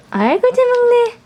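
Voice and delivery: high, fast, squealing praise. Real, not acted. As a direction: gush over the pup. Cooing at the dog eating.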